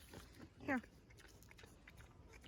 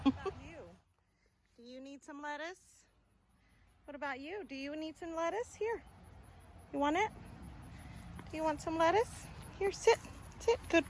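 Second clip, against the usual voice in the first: pet talk; 6 semitones higher, 8 dB quieter than usual. Real, not acted. Coaxing the dog with lettuce.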